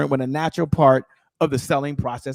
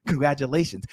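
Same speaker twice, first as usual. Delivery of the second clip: usual speaking voice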